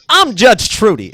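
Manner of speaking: playful imitation of a kids' show judge; mock Judge Trudy. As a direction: ham up the TV judge.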